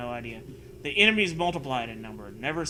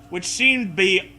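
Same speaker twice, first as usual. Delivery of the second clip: mock glitchy voice; 5 semitones higher, 7 dB louder, much slower than usual. Mock-reading the garbled text.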